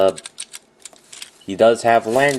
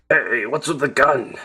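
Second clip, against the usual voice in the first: toy character voice; 4 semitones higher than usual. Pretend. Toy skit voice.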